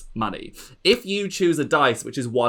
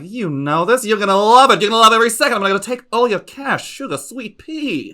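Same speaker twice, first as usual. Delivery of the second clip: high, loud gushing diva; gloating aunt voice; 10 semitones higher, 10 dB louder, faster than usual. Acted. Lady Ziza taunting the player at the dice table, high gushing diva. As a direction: coo the taunt sweetly, pitched way up. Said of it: "she's like".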